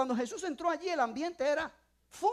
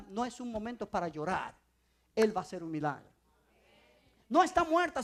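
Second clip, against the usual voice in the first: normal talking voice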